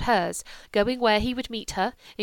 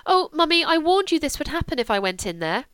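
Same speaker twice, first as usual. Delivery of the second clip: much higher and louder oh mummy; excited exclamation; 8 semitones higher, 5 dB louder than usual. Acted. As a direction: burst out with the news. Julia's exclaimed news.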